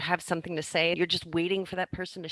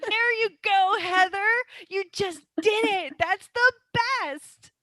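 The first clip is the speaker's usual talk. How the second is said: high, loud delighted cheer; excited praise; 13 semitones higher, 6 dB louder than usual. Real, not acted. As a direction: cheer them on, pitched way up. Delighted praise for game success.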